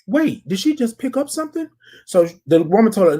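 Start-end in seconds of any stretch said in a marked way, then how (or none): 0.1-1.7 high, startled question; surprised double-take. Retold or imitated. Shopper spotting a theft: surprised and high. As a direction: catch it mid-thought, pitched up. Retold surprised thought in store.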